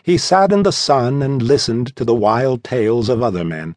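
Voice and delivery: ordinary delivery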